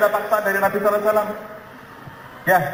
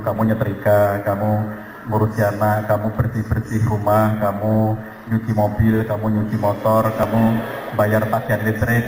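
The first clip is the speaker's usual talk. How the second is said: slow, lower, drawn-out list of orders from a lordly husband; bossy husband listing chores; 7 semitones lower, slower than usual. Retold or imitated. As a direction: hand down every chore one by one, taking your time. Husband assigns all chores to wife. (suami yang memerintah dengan lambat dan suara rendah)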